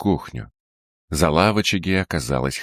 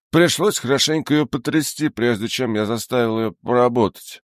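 put-on much higher whiny drunken tailor; whining drunk tailor; 9 semitones higher than usual. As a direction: whine it, scared and tipsy. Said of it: "заскулил перепуганный портной".

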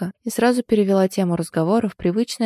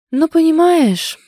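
high, loud, long-drawn stalling; sighing hesitation; 10 semitones higher, 8 dB louder, slower than usual. A narrator's reading. Heroine struggling to explain herself, sighing. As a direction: draw it out while searching for an explanation. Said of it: "вздохнула я".

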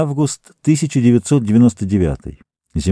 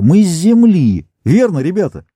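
proud declaration; 7 semitones higher, 4 dB louder than usual. Acted. Captain proudly declares origin.

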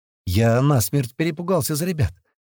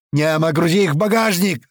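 high, urgent shout; yelled order; 6 semitones higher, 4 dB louder than usual. A narrator's reading. Narrator to gunner loading the wounded, yelling. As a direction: holler an order to a mate. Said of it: "ору ему".

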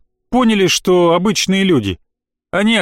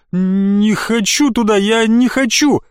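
high, slow panicked plea; high slow frightened protest; terrified refusal; 6 semitones higher, slower than usual. Acted. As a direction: refuse in mounting terror. Terrified boy backing away.

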